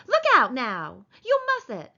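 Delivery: warning turning to alarm; Curly's wife alarmed. Acted.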